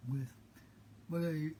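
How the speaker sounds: normal talking voice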